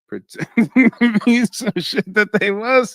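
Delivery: high, loud words through laughter; laughing. Real, not acted. Host cracking up over his own film theory, laughing. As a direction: crack up while talking, pitch soaring.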